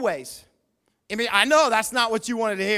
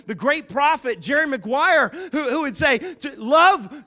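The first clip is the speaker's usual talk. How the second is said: mock grand proclamation; 4 semitones higher, 6 dB louder than usual. Mock-grand build-up to movie quote.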